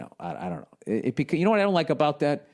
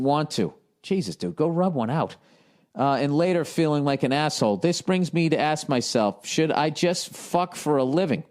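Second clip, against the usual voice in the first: letter reading; 4 semitones higher than usual. Acted. Reads letter aloud.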